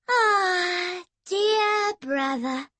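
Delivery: high, slow, sugary coo. Acted. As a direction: coo the words to annoy him. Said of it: "cooed Emily".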